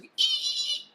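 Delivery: high squeaky braking noise; screech. Pretend. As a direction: imitate a sudden screeching halt. Sudden stop of everything.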